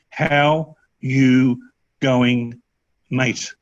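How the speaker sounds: "how you ... going ... mate" broken up with pauses; word by word. Shown as a demonstration. Tutor showing learners the Australian greeting, slowly word by word. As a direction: spell the Aussie greeting out slowly in pieces. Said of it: "So it's a, a type of that".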